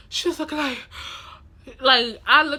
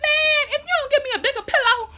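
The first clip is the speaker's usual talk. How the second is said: much higher, fast indignant warning; mad threat; 4 dB louder, faster than usual. Retold or imitated. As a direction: squeal the threat at the nurses. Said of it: "So I was so mad".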